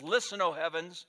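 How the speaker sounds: booming divine proclamation; voice of God. Acted. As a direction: thunder it like God calling heaven to witness. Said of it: "And he says".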